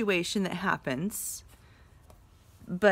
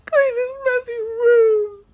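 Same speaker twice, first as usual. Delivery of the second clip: high, loud, slow crying; mother crying over the messy room; cried; 11 dB louder, slower than usual. Shown as a demonstration. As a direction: sob it out like an upset mom. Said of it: "cried Mother".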